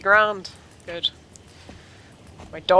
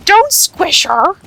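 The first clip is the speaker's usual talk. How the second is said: loud, much higher, slow cry; loud plea; 12 semitones higher, 13 dB louder, much slower than usual. Real, not acted. Telling the rider off for squishing the dog, loud high plea. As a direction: shout it out to save the dog.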